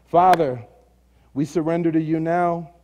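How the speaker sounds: slow, earnest prayer voice; bow the head and lead them in prayer; closing prayer. Acted. Pastor leading the church in a closing prayer, slow and earnest.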